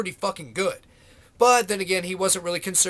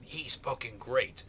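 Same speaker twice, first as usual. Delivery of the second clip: sarcastic; 7 semitones lower, 11 dB quieter, slower than usual. Pretend. Sarcastic aside about Carson.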